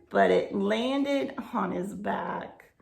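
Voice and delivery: ordinary delivery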